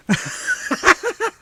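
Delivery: high-pitched giggle; soft, high laughter; laughing. Real, not acted. Laughs at mom's coffee remark.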